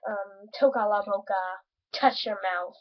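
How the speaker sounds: ordinary delivery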